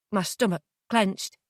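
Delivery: ordinary delivery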